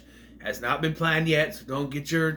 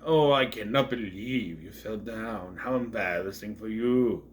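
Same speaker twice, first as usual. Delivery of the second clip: snooty judging cat; 5 semitones lower, slower than usual. Retold or imitated.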